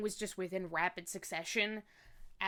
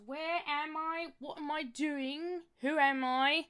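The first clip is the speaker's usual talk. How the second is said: playful mock Australian accent, a bit British; lightly done Aussie accent drifting British; 4 semitones higher, slower than usual. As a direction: put on a hammed-up Australian accent. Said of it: "what on earth is with this accent".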